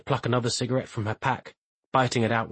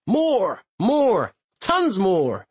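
excited; 9 semitones higher, 4 dB louder, much slower than usual. Acted. Excited reply at dim sum.

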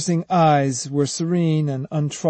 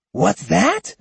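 rasped; 7 semitones higher than usual. A narrator's reading. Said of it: "rasped Kojiro".

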